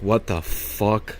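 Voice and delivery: slow, soft, half-asleep what the fuck; groggy confused mumble. Retold or imitated. Student waking in class to a teacher's shoulder squeeze, slow confused mumble. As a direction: mumble it like you just woke up.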